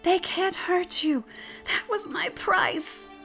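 very high, strained last words; anguished farewell. A narrator's reading. Leap's last words to Pig, anguished. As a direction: call your last words, slowing at the end.

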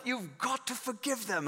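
imitated British pastor; British accent. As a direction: insist in a put-on British accent. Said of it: "who is this British guy".

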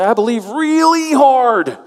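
high, slow, straining "I believe really hard"; hammed-up trying too hard; mock earnest believer. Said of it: "to say".

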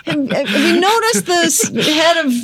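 mock the critics, astonished; incredulous laughing rant. Mocking angry viewers over accent.

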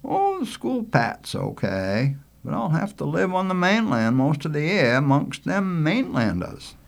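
a hint of a reluctant country kid; reluctant boy Richie. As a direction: grumble mildly as a boy facing school away from home. Richie explains his reluctance.

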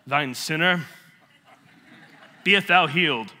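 hammed-up archaic preacher proclamation; pompous parody of holy decree; mock faith healer. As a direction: pause grandly and pronounce a mock healing. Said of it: "You don't have to be like".

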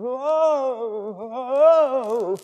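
high hammed-up wail of a hired mourner; mock wailing. Preacher mimics North Korean hired mourners, mock wailing.

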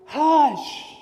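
high, loud acted yell; one big commanding shout. Kids playing Jesus tell the storm, yelled command. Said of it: "and they yell out".

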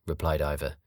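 ordinary delivery, nothing marked

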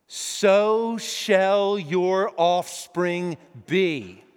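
slow resonant voice of God from the sky. Acted. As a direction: let each word fall like a divine promise. The voice of God comes.

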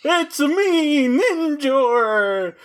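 high crying goofy warrior voice; Ninjor voice. Pretend. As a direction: wail it like a silly hero. Voicing Ninjor character.

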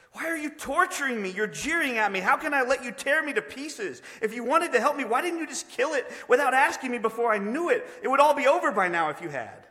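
high, loud, anguished accusation; tormented ghost. Acted. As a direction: wail in protest at your tormentor.

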